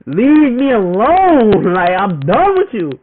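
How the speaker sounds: drawn-out shout at her; slow, loud, fed up; exasperated yell. Retold or imitated. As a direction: yell it like you're done with her. Retold cursing out his girlfriend.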